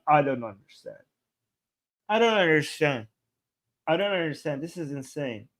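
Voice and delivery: a real, quiet exasperated fade; fading disbelief. Real, not acted. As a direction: let it fade as you give up. Exasperated at the clip.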